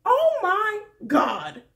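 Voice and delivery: loud, high, slow disbelief; stunned. Retold or imitated. Hearing Ari's harsh words, loud stunned gasp. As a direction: stretch it out, stunned. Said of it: "And I was just like".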